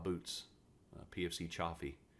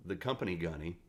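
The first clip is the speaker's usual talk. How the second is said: normal talking voice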